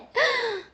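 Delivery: high gasp breaking into laughter; delighted laughing gasp. Real, not acted. Streamer gasping happily after success. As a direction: gasp with glee.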